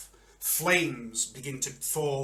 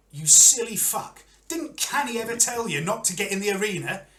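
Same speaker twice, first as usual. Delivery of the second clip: higher, louder telling-off; exasperated scolding; 8 semitones higher, 8 dB louder than usual. Acted. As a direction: berate a foolish friend. Champion scolds friend.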